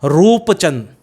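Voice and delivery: रूपचंद with a long "rooop"; drawn-out "oo" in रूपचंद; long-vowel रूपचंद. Shown as a demonstration. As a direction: stretch the first vowel of रूपचंद. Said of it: "longer sound है".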